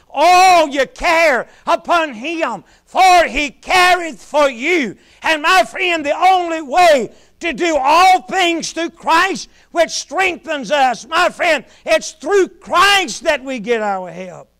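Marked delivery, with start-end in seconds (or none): none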